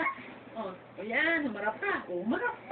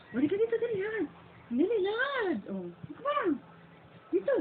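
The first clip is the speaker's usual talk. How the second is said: high coaxing call; 7 semitones higher than usual. Real, not acted. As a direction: coax brightly from across the room. Calling baby back, coaxing.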